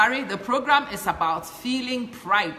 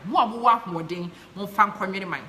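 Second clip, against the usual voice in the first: ordinary talk, nothing marked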